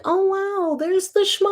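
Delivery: high, bright "oh, wow"; delighted surprise. Retold or imitated. Finding the Shema in the book, high and delighted. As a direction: find it and light up. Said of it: "I was like".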